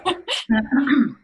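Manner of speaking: high, loud throat clearing. Real, not acted. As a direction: clear your throat forcefully. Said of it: "so let me clear my throat".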